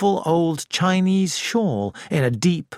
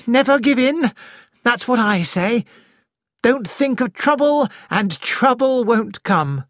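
higher and louder, bravely cheerful; laughing bravado; 9 semitones higher, 3 dB louder than usual. A narrator's reading. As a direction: throw it out with forced gaiety, slowing at the end. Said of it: "She was laughing".

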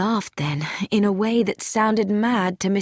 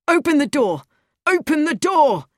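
raised, urgent shout; shouted demand; 8 semitones higher, 4 dB louder than usual. A narrator's reading. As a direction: shout to get the door opened. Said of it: "he shouted".

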